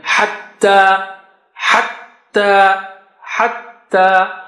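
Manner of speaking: hatta with a held double t, slow; teacher drilling hatta slowly; shadda drilled. Shown as a demonstration. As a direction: stretch out each hatta. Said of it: "shadda with standing fatha".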